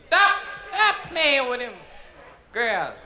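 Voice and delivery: put-on high, slow old-lady scold; grandmother scolding. Grandmother calls to the kids from the window, high old-lady scold. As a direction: call out like grandma. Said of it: "grandmother used to look out and say".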